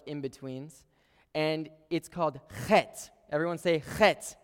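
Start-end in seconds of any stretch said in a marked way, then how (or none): 2.7-3.1 "chet" with a throat-scraping Hebrew ch; throaty chet. Shown as a demonstration. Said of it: "you make the noise just like it sounds". 4.0-4.4 "chet" with a throat-scraping Hebrew ch; throaty chet. Shown as a demonstration. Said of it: "you make the noise just like it sounds".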